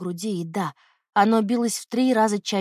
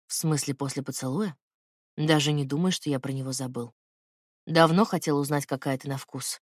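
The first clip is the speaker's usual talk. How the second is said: lightly lowered man's voice, intimate; low male lover voice; 5 semitones lower than usual. Acted. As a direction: speak low as the tender man.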